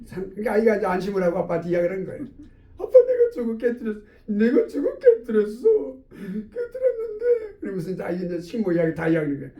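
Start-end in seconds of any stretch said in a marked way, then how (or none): 2.8-7.6 put-on high, wailing child voice, drawn out; tearful child confession. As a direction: act a child sobbing out a confession. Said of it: "막 통곡하면서 울기 시작하는 거예요". (아이처럼 울먹이며 고백)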